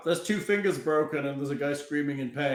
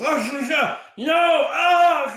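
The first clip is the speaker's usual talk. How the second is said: loud pained yell, pitched up; 10 semitones higher, 6 dB louder, much slower than usual. Acted. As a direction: cry out in sudden pain. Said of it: "he kinda yells".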